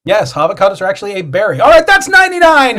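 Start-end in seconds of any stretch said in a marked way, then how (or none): 1.6-2.8 high, raised announcing voice; pitched-up game host shout; host's wrap-up call. Real, not acted. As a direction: call time on the round over the crowd. Host calls the game's end.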